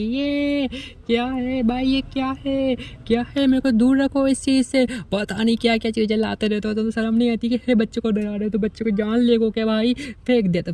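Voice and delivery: hammed-up horrified yelp; mild mock scream. Man recoiling from the strange ball creature, high mock panic. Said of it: "उसे चिल्लाने लग जाता है".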